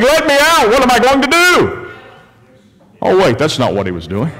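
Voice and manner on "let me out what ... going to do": high-pitched, hammed-up desperate prisoner; play-acted panicked cry; mock screaming. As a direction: climb into a frantic mock plea.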